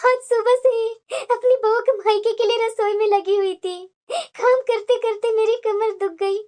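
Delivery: crying lament with sobbing breaths; tearful hurt. Acted. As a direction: pour out your humiliation through tears. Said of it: "तुम्हारी आंखों में आंसू क्यों है". (रोते हुए, आहत स्वर में)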